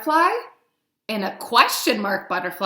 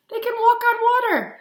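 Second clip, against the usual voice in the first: much higher and quicker, full of wonder; amazed; 13 semitones higher, 4 dB louder, faster than usual. Real, not acted. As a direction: gasp it out like it's magic. Amazed at water striders.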